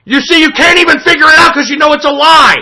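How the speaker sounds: high-pitched triumphant retort; real argumentative outburst; heated accusation. Real, not acted. Angry quarrel.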